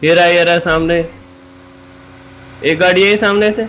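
high, urgent callout; excited warning. Real, not acted. Player warning teammates of a car ahead, excited callout.

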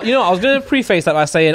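ordinary talk

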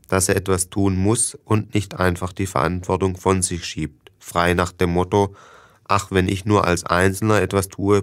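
usual speaking voice

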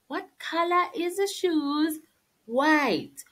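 ordinary delivery

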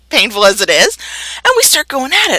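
usual speaking voice

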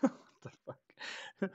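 slow, breathy disbelief; stunned whisper. Real, not acted. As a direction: whisper it in shock. Reacting to sketch twist.

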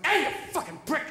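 high, loud mock-angry yell; hammed-up aggressive stoner picking a fight. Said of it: "an angry pot smoker".

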